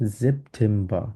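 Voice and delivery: সেপ্টেম্বর drawn out as "zep-tem-ba". Shown as a demonstration. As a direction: model সেপ্টেম্বর slowly with an initial Z. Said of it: "আমরা সেটের মতো উচ্চারণ করব". (সেপ্টেম্বর, শুরুতে জ় ধ্বনি)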